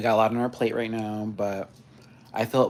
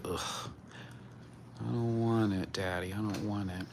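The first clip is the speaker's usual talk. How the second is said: reluctant cat voice; 2 semitones lower, 9 dB quieter than usual. Retold or imitated. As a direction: grumble it as the cat who hates brushing. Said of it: "He's like".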